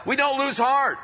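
preaching shout. Real, not acted. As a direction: proclaim it to the whole room. Preacher shouts the key line.

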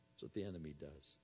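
ordinary delivery

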